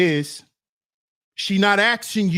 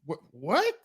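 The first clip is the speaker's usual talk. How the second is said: mock startled; 3 semitones lower than usual. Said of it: "You're gonna be like".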